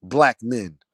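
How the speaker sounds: usual speaking voice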